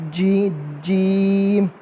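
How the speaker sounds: जीम drawn out slowly; stretched jeem. Shown as a demonstration. Teacher stretching जीम for the children, very slow. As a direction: pull जीम out long. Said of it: "इस तरह खींच के".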